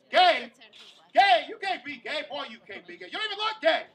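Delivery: loud, high outraged disbelief; shocked family. Retold or imitated. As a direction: gasp it in scandalized shock. Family shocked at his news.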